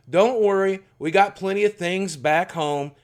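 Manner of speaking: a lightly voiced grandfather giving an ultimatum; threatening grandfather. Grandfather threatening his son with a shotgun, in a loud menacing voice. Grandfather ordering dad, retold.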